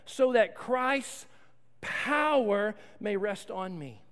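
very slow, each word set apart; slow, weighty. Acted. Pastor reading Paul's reason to the church, slow and weighty. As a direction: lean on every word, slowly.

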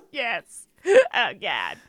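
laughing through tears; laughing, teary confession; laugh-crying. Real, not acted. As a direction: giggle helplessly while admitting it.

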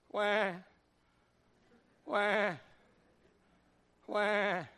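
low, soft mock wail; mock baby cry. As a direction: fake a babyish sob. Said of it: "sucking his thumb".

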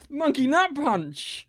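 high, drawn-out shout; shouted show title. Real, not acted. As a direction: belt out the show's name, high and slow.

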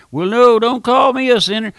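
mock-offended listener, high and loud; indignant sinner. Preacher voicing a sinner who refuses the label, high and loud in mock offence. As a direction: squawk it back like an insulted churchgoer.

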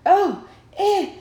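hammed-up shocked ohs breaking into a laugh; mock shock. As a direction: act out being scandalized while reading. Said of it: "I was like".

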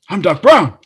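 loud, high proud declaration; Doc Brown impression. The actor's parting line to the tourists, loud proud Doc voice. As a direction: announce it like a mad scientist. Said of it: "And he just went".

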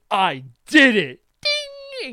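loud, slow mock triumph, high ding; eager student. Lee showing off to his sensei, hammed-up triumph. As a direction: proudly announce it, hammed up. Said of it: "He looks at Guy Sensei".

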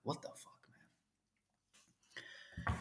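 breathy whispered what the fuck; whispered disbelief. Retold or imitated. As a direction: mutter it in stunned disbelief under your breath. Stunned reaction to weird gestures.

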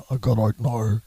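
low, put-on bomb-threat phone voice; low mock-menacing Derry voice; bass-level Derry voice. Shown as a demonstration. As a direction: drop it down to a bass warning. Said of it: "sort of base level".

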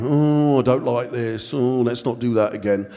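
low, dreary mock groan; moaning and groaning. Preacher mimicking the church complainer, hammed-up moaning and groaning. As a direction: moan it like a life-draining pessimist. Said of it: "he would just moan and groan".

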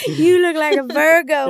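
loud, high mock pickup line; over-the-top put-on seductress; hammed-up come-on. Jokes as a luring spirit.

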